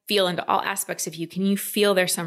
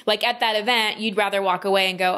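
ordinary talk

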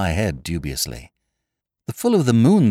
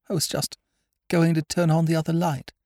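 lightly held, higher lady's voice; shaken noblewoman; 7 semitones higher than usual. A narrator's reading. Recovering lady starts her story of the attack, high voice. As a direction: tell it in a high, careful voice.

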